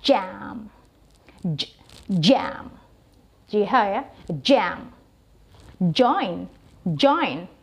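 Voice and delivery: jam and join with a strong j; model pronunciation. Shown as a demonstration. Teacher modelling j in jam and join. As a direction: press the j in jam. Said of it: "Emphasize-nya dia lebih strong".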